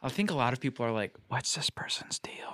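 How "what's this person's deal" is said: whispering. Retold or imitated. Coworkers quoted judging assistant.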